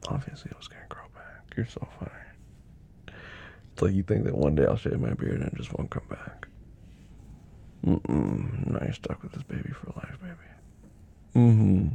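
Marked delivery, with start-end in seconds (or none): 0.1-6.5 playful teasing whisper. Acted. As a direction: tease her under your breath.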